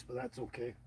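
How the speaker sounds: ordinary delivery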